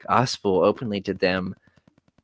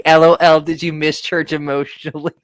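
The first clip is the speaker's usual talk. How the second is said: mocking laugh; 6 semitones higher, 7 dB louder than usual. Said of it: "They're like".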